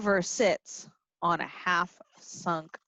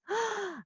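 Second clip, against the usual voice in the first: sharp gasp of mock surprise; dramatic gasp; 10 semitones higher, 8 dB quieter than usual. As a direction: gasp for the kids. Reading to children, gasp.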